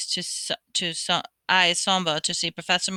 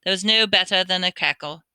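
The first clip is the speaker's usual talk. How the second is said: plain reading voice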